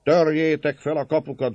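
high, drawn-out rallying cries; voiced crowd clamour, pitched high; crowd's roar. A narrator's reading. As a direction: call the mob to attack. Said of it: "zúgott a nép".

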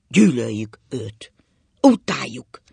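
loud, slow, emphatic loathing; forceful, drawn-out declaration; shouting hatred. A narrator's reading. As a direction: spit it out loudly and slowly. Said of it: "kiabált Ákos".

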